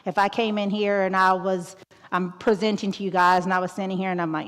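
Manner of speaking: usual speaking voice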